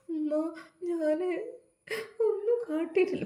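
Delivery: frightened crying. Acted. Frightened daughter-in-law pleading innocence to mother-in-law, crying high and soft. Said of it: "Shereena ഭയന്ന് വിറച്ച്".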